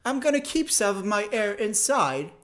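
self-important British caricature; arrogant British imitation. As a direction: hoard your breath in a snooty British accent. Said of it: "it feels arrogant that they're like".

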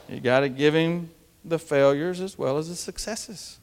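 usual speaking voice

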